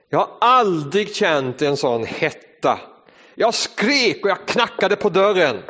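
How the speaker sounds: ordinary talk, nothing marked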